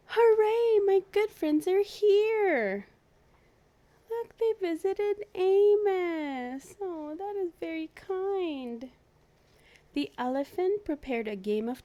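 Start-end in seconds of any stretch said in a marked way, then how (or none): 0.1-8.9 high, loud, slow delighted cheer; joyful Amos. Acted. Amos overjoyed that his zoo friends came. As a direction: cheer like a thrilled sick friend.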